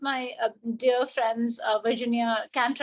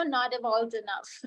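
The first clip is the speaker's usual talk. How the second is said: ordinary talk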